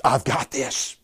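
God quietly reassuring his people; calm voice of God. Acted. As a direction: speak it gently as God comforting. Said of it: "the word of the Lord is".

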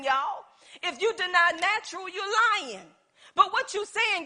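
ordinary talk, nothing marked

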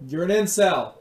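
sharp loud scold; yelling at dog. Real, not acted. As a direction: snap it at the dog.

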